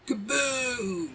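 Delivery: high, held "boom" that drops at the end; drawn-out cartoon kaboom; high-pitched sound-effect call; mock explosion cry. Mimicking game's explosion cry.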